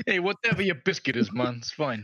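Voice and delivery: put-on loud, high Irish-Italian wiseguy accent. To friends, mock Irish Italian accent. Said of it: "can you say it like an Irish Italian accent".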